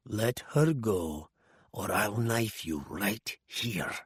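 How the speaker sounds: a near-murmured knife threat. A narrator's reading. Arkadin threatening Osirov with a knife, very quiet. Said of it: "he added even more quietly".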